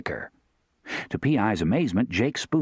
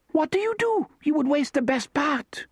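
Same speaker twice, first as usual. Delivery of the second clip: put-on very high French-accented scolding; scowling French woman. A narrator's reading. Therese scolding the vaquero over pig guts, French accent. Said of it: "Therese said, scowling".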